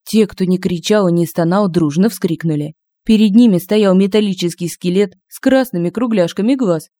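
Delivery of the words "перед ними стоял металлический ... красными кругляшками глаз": ordinary delivery, nothing marked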